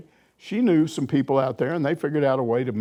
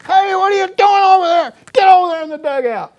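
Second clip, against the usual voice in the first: loud, much higher yell at a player; screaming; 11 dB louder than usual. Retold or imitated. Coach screaming at a player from third base, loud yell. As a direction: bellow across the field at a player. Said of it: "he'd just start screaming at some guy".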